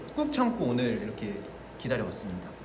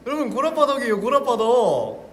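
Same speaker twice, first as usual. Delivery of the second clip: high, loud delighted cheer; thrilled to show the card; excited; 11 semitones higher, 11 dB louder than usual. Real, not acted. Excited unveiling Psyduck card.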